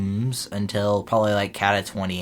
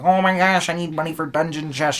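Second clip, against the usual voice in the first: higher, louder whine; a hammed-up higher whine about money; mock whiny friend; 8 semitones higher, 5 dB louder than usual. Friend Jake imitated whining.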